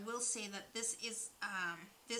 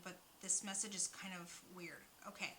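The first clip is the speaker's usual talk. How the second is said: ordinary talk, nothing marked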